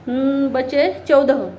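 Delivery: ordinary delivery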